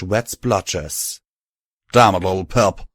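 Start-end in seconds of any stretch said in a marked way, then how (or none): 1.9-2.9 scolding. A narrator's reading. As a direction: scold him, rough and loud. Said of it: "Calamity scolded".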